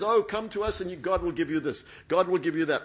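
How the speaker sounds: mock the cheap promises; mocking prosperity preacher. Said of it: "prosperity cult churches that says".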